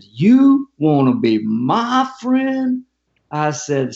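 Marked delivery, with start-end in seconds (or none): 0.0-2.8 slow, high-swooping disbelieving question; incredulous. Retold or imitated. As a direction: stretch it out in mock disbelief. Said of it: "with this incredulous look".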